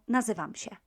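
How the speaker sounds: "nazywam się" run together, się as "sheh"; very quick. Shown as a demonstration. Teacher showing the quick form, rapid "nazywam się" with no nasal tail. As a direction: rattle off "nazywam się" as one word. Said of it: "very quickly".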